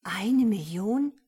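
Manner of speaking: slow, voiced-out awe; awed murmur. A narrator's reading. Woman guessing at the money, awed. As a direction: draw the guess out in wonder. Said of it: "Ehrfürchtig murmelte sie".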